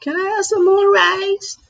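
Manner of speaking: very high, pleading little voice asking for rice; begging dog voice. Retold or imitated. Imitating Tracy the dog begging Sean for rice, very high whine. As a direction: beg in a tiny high whine like a hungry pup. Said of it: "Sean didn't want her whining".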